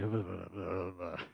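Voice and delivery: low, soft wordless mumble; mock mumbling. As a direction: imitate a half-hearted congregation mumbling along. Mimics congregation's half-hearted repeat.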